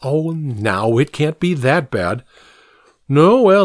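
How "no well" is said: exasperated outburst. Acted. Santa grumbling about his ordeal.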